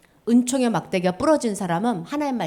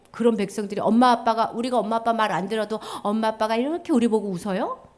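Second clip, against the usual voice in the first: ordinary talk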